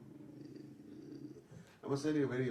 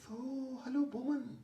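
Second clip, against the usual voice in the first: high soft breathy praise; lightly whispered mock praise; imitating Javed Sahab; 5 dB quieter than usual. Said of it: "So Javed Saheb said".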